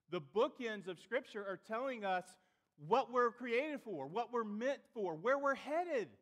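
loud, high, emphatic sermon delivery; impassioned preaching. Real, not acted. Pastor telling church what the goal is not, impassioned preaching. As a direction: preach it forcefully, pausing for weight.

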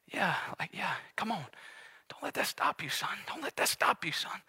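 very soft, near-whispered begging; hushed pleading. Acted. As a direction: coax your child on under your breath. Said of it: "begging and pleading for me by name".

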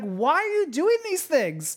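high-pitched, drawn-out bewildered question; baffled onlooker. Acted. Onlooker questioning rioters, baffled. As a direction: ask it in incredulous dismay. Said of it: "You're like".

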